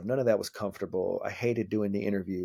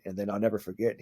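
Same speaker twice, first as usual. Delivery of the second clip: ordinary talk, nothing marked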